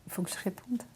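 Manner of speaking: whisper. Acted. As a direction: whisper as an excuse to slip away. Dodging an awkward question.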